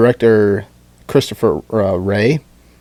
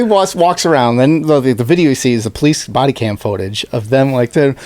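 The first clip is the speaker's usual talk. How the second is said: ordinary talk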